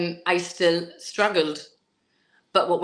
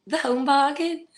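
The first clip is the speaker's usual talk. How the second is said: higher-pitched Scouse imitation; Scouse accent; 8 semitones higher than usual. Showing the host how Scousers name the shop, imitated Scouse accent. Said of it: "They'd say".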